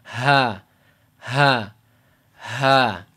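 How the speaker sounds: "ha" sighed out loudly from the chest; "ha" as a heavy, falling exhale, repeated with pauses; exaggerated sighed H. Shown as a demonstration. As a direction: overdo each "ha" from the bottom of the throat. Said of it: "this letter is".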